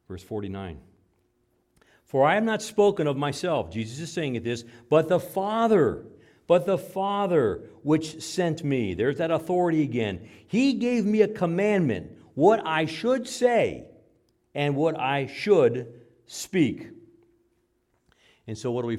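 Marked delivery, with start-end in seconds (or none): 2.0-3.7 loud, high preacher's proclamation; preaching scripture. Acted. Preacher reading scripture to the church, loud and high. 4.9-8.9 loud, high preacher's proclamation; preaching scripture. Acted. Preacher reading scripture to the church, loud and high. 10.5-16.9 loud, high, slow proclamation; preaching scripture. Acted. Preacher proclaiming the Father's command, loud and slow. Reading scripture at full force.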